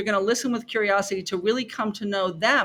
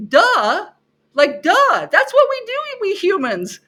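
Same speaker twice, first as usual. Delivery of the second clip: much higher and louder, teasing duh; mock duh; 12 semitones higher, 8 dB louder, slower than usual. Teacher imagining telling students the obvious, teasing and loud. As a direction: playfully roll your eyes at the obvious. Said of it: "I always wanna laugh and say".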